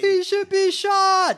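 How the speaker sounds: high, stretched mock crowd yell; imitated yelling. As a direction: yell it like a heckler at a rally. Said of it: "someone yelling".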